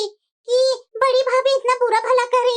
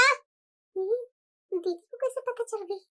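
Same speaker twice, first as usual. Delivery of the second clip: much softer, slow aside under her breath; worried aside; 3 semitones lower, 11 dB quieter, much slower than usual. Acted. Younger daughter-in-law wonders to herself, hushed and slow. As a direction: mutter it to yourself, alarmed and slow.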